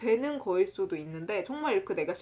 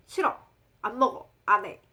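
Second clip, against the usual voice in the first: 짧게 끊어 말하는 어린 시절의 거절 흉내; 어린 시절 떼쓰기; 5 dB louder than usual. Retold or imitated. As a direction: imitate a child's habitual curt refusals. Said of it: "어린 시절에 항상". (어린아이처럼 싫다고 떼쓰듯)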